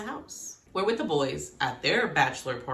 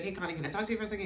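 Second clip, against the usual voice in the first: very fast, hushed request; rushed Belly; 2 semitones higher, 6 dB quieter, much faster than usual. Retold or imitated. Quoting Belly asking Connie, very fast. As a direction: blurt it out in one breath. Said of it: "she's like".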